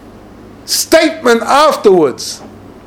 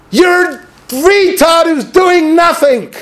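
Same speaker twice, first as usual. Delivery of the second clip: scornful retort; 8 semitones higher, slower than usual. Retold or imitated. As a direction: lean in and sneer each word slowly. Rabbi's retort to the student.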